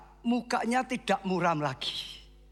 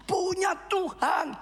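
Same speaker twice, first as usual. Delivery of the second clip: preaching at full force; 10 semitones higher than usual. Acted. Pastor shouts encouragement to the congregation, full fervour. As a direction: preach it out with fire.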